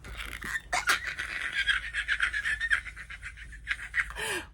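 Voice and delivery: breathless unvoiced silent scream. Real, not acted. While nursing her sleeping baby, a silent scream. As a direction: scream with no voice, high. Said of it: "I'm silent screaming like that".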